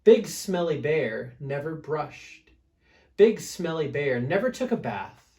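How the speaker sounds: ordinary delivery, nothing marked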